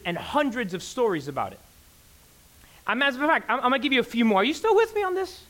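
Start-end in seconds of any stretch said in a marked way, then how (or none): 4.4-5.4 much higher, eager check on the crowd; lively pastor's check-in, much higher; animated check-in. Real, not acted. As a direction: pull the room back in with a bright question. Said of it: "checking in on the crowd".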